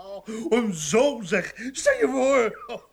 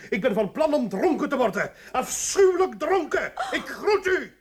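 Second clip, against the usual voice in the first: higher, faster, sneering laugh; defiant mockery; 6 semitones higher, 5 dB louder than usual. Husband leaving his wife to get drunk, mocking and defiant. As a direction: toss it off mockingly.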